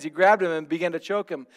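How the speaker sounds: ordinary talk, nothing marked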